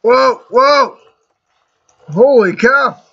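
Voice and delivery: high startled yelps; surprised shout. Real, not acted. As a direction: blurt it out in shock. Sudden surprise while gaming.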